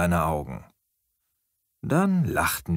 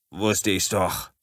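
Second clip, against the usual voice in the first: blustering; slower than usual. A narrator's reading. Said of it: "polterte Sam".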